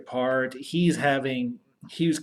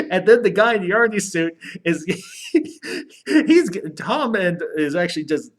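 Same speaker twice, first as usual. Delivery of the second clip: laughing while telling the story; higher, louder, broken up by laughter; cracking up; 7 semitones higher, 7 dB louder than usual. Real, not acted. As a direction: struggle to talk through giggles. Cracks up retelling the fight.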